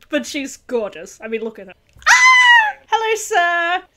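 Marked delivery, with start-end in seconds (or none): 2.1-3.8 shrieking high and loud at a handsome man; loud high fangirl shriek; excited squeal. Real, not acted. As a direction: gush like a starstruck fan. Swooning over a character.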